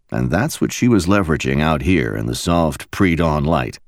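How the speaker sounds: ordinary delivery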